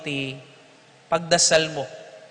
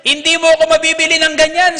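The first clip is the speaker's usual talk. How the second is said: very high, loud furious priest; raging refusal; 12 semitones higher, 10 dB louder than usual. Retold or imitated. Priest throwing books at the briber, furious shout. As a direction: explode at the briber. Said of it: "Nagwala ang pare".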